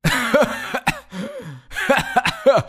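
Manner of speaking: high scornful laugh; mocking laugh. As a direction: burst out laughing at him. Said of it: "bis der Eisbär anfing zu lachen".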